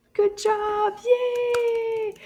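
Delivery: high sing-song cheer; teacher's high-pitched hooray for little learners; cheering praise. Real, not acted. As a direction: celebrate with a long, high, held yay.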